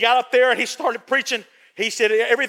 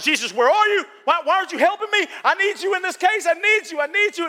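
pleading, pitched higher and hurried; desperate plea; 7 semitones higher than usual. Retold or imitated. Believer calling on Jesus for help, pleading higher and faster. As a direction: beg urgently, voice climbing. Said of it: "I was like".

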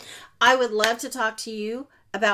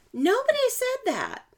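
"Nobody" punched much higher; stress on NOBODY; 12 semitones higher than usual. Real, not acted. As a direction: throw "Nobody" up high and hard. Emphatic repeat of a point.

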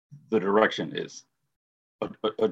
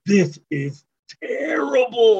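high, slow, louder groan of disbelief; mock exasperation; 13 semitones higher, 7 dB louder, slower than usual. Co-host telling friend his reaction, hammed-up high slow dismay. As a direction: stretch it out like you can't believe it.

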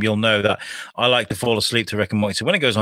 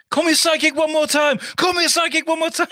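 hammed-up threatening shout, laughing on the repeat; shouted quote. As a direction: shout it like a furious character. Quoting show line.

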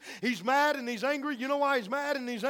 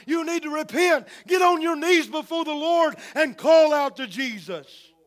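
loud, higher urgent exhortation; full-force preaching; 4 semitones higher, 6 dB louder than usual. Acted. Pastor urges believers not to quit witnessing, loud and urgent. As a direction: drive it home like a revival preacher.

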